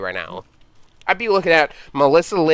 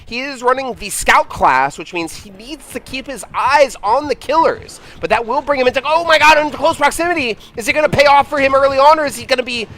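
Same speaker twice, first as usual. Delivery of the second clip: sportscaster voice; 6 semitones higher than usual. Acted. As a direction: hype up the game like a TV sportscaster. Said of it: "I don't have to do the sportscaster voice".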